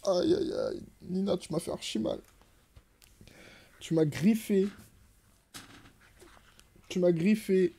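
gémit plus haut, vexé envers le chat; plainte de douleur. Real, not acted. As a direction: reproche plaintif, voix montée. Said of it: "qui m'a griffé toute la cuisse". (se plaint au chat qui l'a griffé, voix plaintive aiguë)

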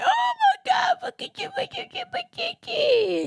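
imitated very high squealing baby talk; tiny high-pitched mock coo; imagined new-mother gushing. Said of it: "I know she was there like".

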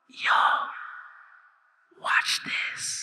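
whispered aside to classmates; sneaky stage whisper; conspiratorial whisper. Acted. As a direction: whisper it behind the teacher's back. Whispering to classmates behind teacher's back.

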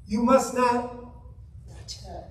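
"You must not touch it." punched then fading to a whisper; stressed added words. Acted. Preacher stresses Eve's added words.

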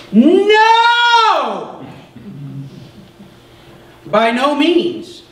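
very high shouted No, then slow; Paul's shout. Acted. Pastor voicing Paul's answer to his readers, shouted then slow. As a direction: cry out the denial like Paul.

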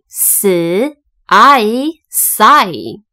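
slow, separated s, I, sigh; sounding out. Shown as a demonstration. Teacher showing Y as long I, sounding out sigh. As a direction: blend s and a drawn-out I into sigh. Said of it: "这里的Y一样是念成I".

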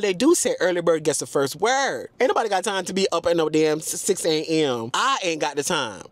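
normal talking voice

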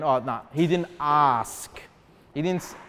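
low, slow, drawn-out mock complaint; mock objection. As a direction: imitate a nitpicker, dragging out "ask". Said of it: "some people that say this".

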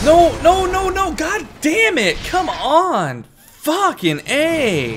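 high, loud cries of no, no, no; real frustration bursting out at the game; frustrated yelling. Real, not acted. Gamer yelling at the screen over another death. Dying again in a hard fight.

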